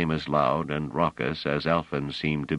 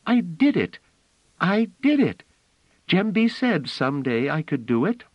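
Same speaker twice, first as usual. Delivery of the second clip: put-on high, loud triumphant little girl; little girl crying out in delight; excited child cry; 12 semitones higher, 4 dB louder than usual. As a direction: shout it like a child's first success. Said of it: "and Phelim cried out".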